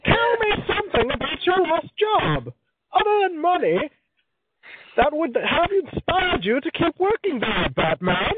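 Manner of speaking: very high, slow villain impression; Bane. Villain interrogating Batman about a last job, high, slow and theatrical. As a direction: draw it out as a grandly theatrical, high-pitched masked villain. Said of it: "That was kind of Bane-ish from Batman".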